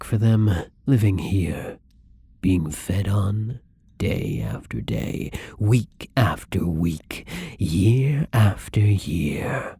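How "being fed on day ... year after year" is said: slowing, fading to a whisper; trailing off into a whisper. Acted. Imagining prisoners' endless suffering.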